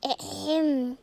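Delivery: ordinary talk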